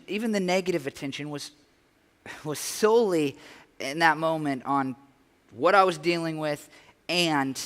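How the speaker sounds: normal talking voice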